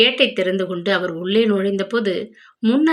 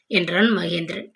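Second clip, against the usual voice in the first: plain reading voice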